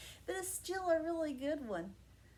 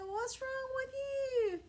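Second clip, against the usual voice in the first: concerned donkey; 8 semitones higher, slower than usual. Acted. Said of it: "He said".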